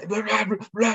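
high-pitched nonsense chatter; mock babbling. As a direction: imitate someone rattling on and on. Imitating the man's endless chatter.

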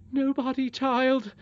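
high, sharp nurse's reply; almost angry. A narrator's reading. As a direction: answer the child with contained irritation. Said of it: "said the nurse almost angrily".

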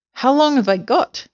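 high, anxious storybook question; crying out. A narrator's reading. Mrs Twit asking her husband how long, crying out. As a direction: ask it high, in dismay. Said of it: "cried Missus Twit".

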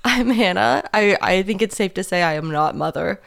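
normal talking voice

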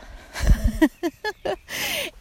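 high laugh while walking uphill; laughing between puffs; breathless laugh. Real, not acted. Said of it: "ich schnaufe nicht so laut".

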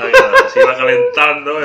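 high, loud laughing remark; laughing along at the rant. Real, not acted. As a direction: talk through a big laugh. Laughing comment.

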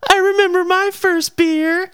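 high mock girly yell from a car; mocking girls yelling. Girls in a car teasing James, high squeal. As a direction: tease him in a high squealing voice. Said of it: "being like".

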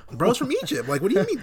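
high, loud disbelief; incredulous outburst. Real, not acted. Ranting to his co-host, high and incredulous. Outraged at Egypt skin color.

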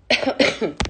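sudden harsh cough; coughing fit. Real, not acted. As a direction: cough mid-sentence. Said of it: "Desculpa, gente".

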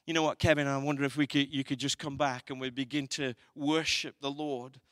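ordinary talk, nothing marked

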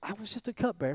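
soft, humble "just a cupbearer", voice dropped; quiet self-effacing take of the line. Shown as a demonstration. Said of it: "or if it was".

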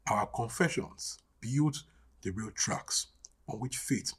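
hushed refrain. Real, not acted. Preacher closing on a repeated confession, hushed and fading. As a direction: let the refrain sink gradually towards a hush.

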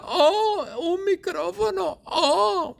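very high excited cry; excited squeal. Acted. [name] spotting the microphone, squealing very high. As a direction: squeal like a fan seeing a treasure.

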